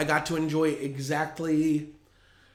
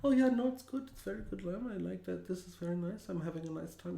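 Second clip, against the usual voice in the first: muttering aunt; 3 semitones higher, 10 dB quieter than usual. Retold or imitated. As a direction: mutter it politely to yourself. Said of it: "My aunt's muttering like".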